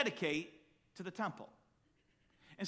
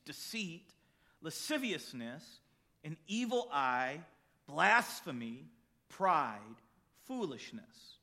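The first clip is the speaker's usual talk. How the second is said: solemn scripture reading; slower than usual. Acted. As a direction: read the verses with weight, letting the list sink in. Reads Mark chapter seven aloud.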